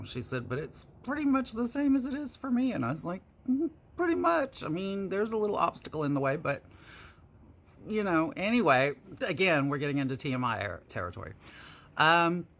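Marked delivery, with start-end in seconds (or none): 0.5-2.7 naive sister. Retold or imitated. 3.5-8.3 high, knowing wry tease; dry, sly, high-pitched quip. Retold or imitated. Wry reply to sister.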